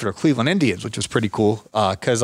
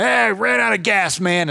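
put-on slow, higher old man's voice; imitating old man; 8 semitones higher, slower than usual. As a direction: play the tired old neighbour. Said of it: "the old man's like".

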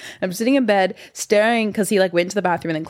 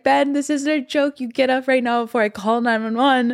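retold panicked warning; 6 semitones higher than usual.

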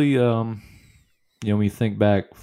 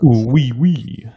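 slow, loud, hammed-up French accent; mock French accent; 5 semitones higher, 11 dB louder, much slower than usual. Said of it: "I hate the accent".

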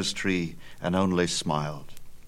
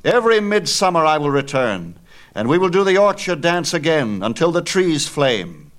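triumphant shout; 9 semitones higher, 7 dB louder than usual. A narrator's reading. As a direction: bellow it proudly as he leaves. Hero's parting promise, shouting.

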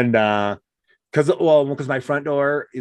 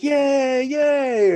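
mock crowd cheer. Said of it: "everybody's like".

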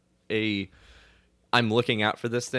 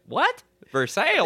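mock confusion; 12 semitones higher than usual. Mock disbelief at local pronunciation.

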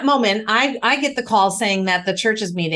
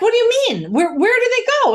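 alarmed confusion; 12 semitones higher, 4 dB louder, faster than usual. Retold or imitated. Alarmed question to her attorney.

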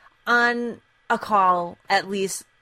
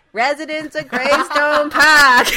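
put-on slow, loud Boston accent, higher; 6 semitones higher, 9 dB louder, slower than usual. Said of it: "this is me doing my Boston accent".

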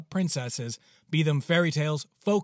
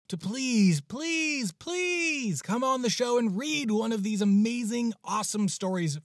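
pleading please, much higher each time; mock pleading; 8 semitones higher than usual. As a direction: beg like a kid asking for a treat. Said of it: "begging the authors to come".

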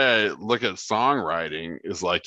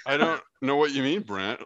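ordinary talk, nothing marked

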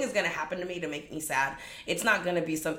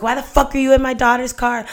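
a louder, higher furious demand; angry dad; 7 semitones higher, 12 dB louder than usual. Retold or imitated. Dad yells at the ex in his daughter's car, angry. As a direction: confront the intruder hard.

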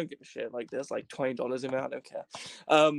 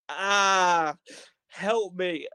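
long, loud, higher wail; mock distress; 5 semitones higher, 6 dB louder, much slower than usual. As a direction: cry for help, hammed up. Playing mental health card.

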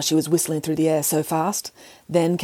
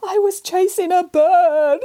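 a high, slow mock wail with a laugh; weeping, slow and high; 13 semitones higher, 4 dB louder, slower than usual. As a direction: sob it out theatrically. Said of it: "wept the cat".

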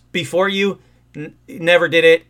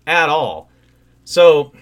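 usual speaking voice

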